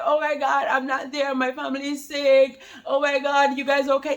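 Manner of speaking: mock-panicked husband checking on his sick family; imitating worried dad. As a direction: play the frantic dad calling in. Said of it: "He was like".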